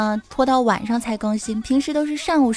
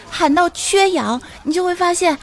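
mock shouting; 6 semitones higher than usual. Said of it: "你就应该大声地喊".

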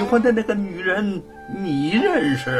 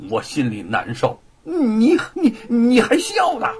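hurt and indignant, rising into a near sob; hurt indignation; 7 semitones higher, 3 dB louder than usual. A narrator's reading. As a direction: protest that he is being laughed at. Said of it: "姚老大愤愤地看着他".

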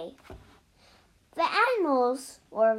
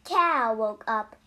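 usual speaking voice

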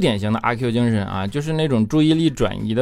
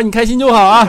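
normal talking voice

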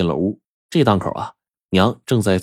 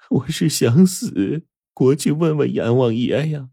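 pleading; 7 semitones higher than usual. A narrator's reading. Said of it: "抱着母亲苦苦哀求说".

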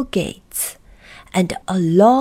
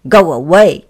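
loud, rude dismissal; loud, brusque brush-off; 3 semitones lower, 10 dB louder than usual. Acted. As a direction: send them off rudely and loudly. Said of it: "said Mister Uppity rudely".

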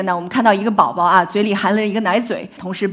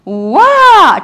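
a mock newborn wail that climbs higher and louder; imitated baby cry; 10 semitones higher, 9 dB louder than usual. Shown as a demonstration. Lecturer imitating a French baby's cry for the audience, rising wail. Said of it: "法语的宝宝哭声是从低到高的".

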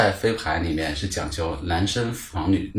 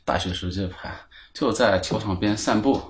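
ordinary talk, nothing marked

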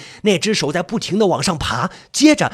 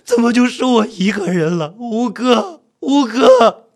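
raised, despairing call building louder; grieving cry; 3 semitones higher, 3 dB louder, slower than usual. Acted. Narrator left alone calling fifth brother, grief-stricken. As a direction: wail for your lost friend.

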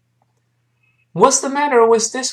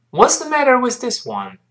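quicker, linked-up model pitched much higher; faster reading; 10 semitones higher than usual. Shown as a demonstration. Teacher speeding up for learners to repeat, faster reading. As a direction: say it faster in one high run. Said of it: "我们快一点儿".

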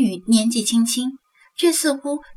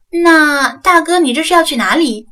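put-on young woman probing; 6 semitones higher, 8 dB louder than usual. A narrator's reading. Young woman asks her follower his destination, probing. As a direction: probe him in a raised, coaxing voice.

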